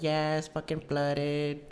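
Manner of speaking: put-on high, very slow girlfriend voice; imitated ex. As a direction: mimic the ex with drawn-out words. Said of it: "She's like".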